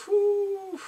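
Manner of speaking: high, drawn-out whew; big whew. Real, not acted. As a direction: let out an overwhelmed whew. Reacting to sad scene.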